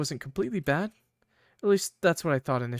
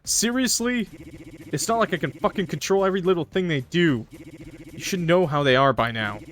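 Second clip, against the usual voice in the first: put-on loud furious boyfriend voice; angry Krovan; 3 dB louder than usual. Angry boyfriend yelling at Mary for ignoring texts. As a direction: yell at her for coming home late.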